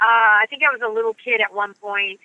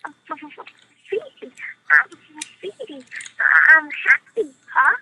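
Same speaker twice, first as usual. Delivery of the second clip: put-on Baby Mumble voice; 6 semitones higher, slower than usual. Shown as a demonstration. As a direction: coo it like a sweet, tiny chick. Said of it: "He's real sweet and tiny".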